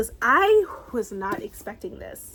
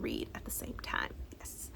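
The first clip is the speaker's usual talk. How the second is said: whisper; 13 dB quieter than usual. Real, not acted. Whispered aside.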